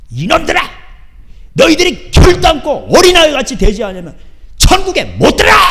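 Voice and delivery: Jesus bellowing at disciples in anger; angry Jesus rebuke. Retold or imitated. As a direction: roar it at the disciples. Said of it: "예수님을 그대로 흉내내는 거예요".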